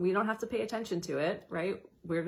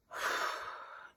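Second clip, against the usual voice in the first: soft, unvoiced long exhale; audible exhale sigh; 5 dB quieter than usual. Shown as a demonstration. Showing listeners a conscious exhale, breathed out softly. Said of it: "a conscious exhale".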